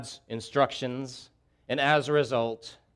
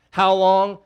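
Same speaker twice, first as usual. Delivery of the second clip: How long called out loud and high; loud cry; 6 semitones higher, 10 dB louder than usual. Acted.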